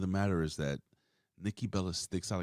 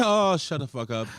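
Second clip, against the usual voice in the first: annoyed outburst; 8 semitones higher, 8 dB louder than usual. Real, not acted. Annoyed outburst after mockery.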